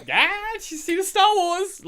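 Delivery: very high, slow, loud cartoon voice; mock audience. Podcaster voicing the audience's delight, high and slow. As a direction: squeal it like a delighted cartoon crowd. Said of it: "and they're like".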